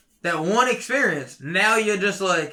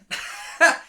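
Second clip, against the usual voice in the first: laughing at himself; 12 semitones higher than usual. Real, not acted. Laughs after admitting his rant.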